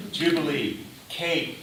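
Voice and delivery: ordinary talk, nothing marked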